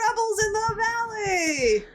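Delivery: high, drawn-out alarm cry, no real volume; slow, high-pitched pretend holler; mock scream. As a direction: imitate a soldier screaming a warning. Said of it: "a Horde soldier comes running in, screaming".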